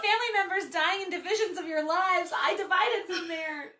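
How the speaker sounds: laugh-crying. Real, not acted. Laughing-crying drunk protest.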